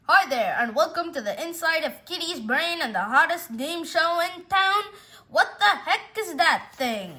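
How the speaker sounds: game show host. Acted. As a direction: sell it like a TV quiz emcee.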